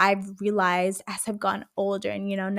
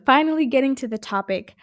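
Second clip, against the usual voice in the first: normal talking voice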